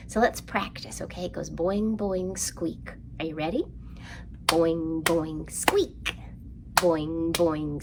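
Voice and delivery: ordinary delivery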